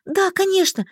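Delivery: high, eager exclamation; overjoyed. A narrator's reading. Heroine gets permission to stay, overjoyed. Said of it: "несказанно обрадовавшись".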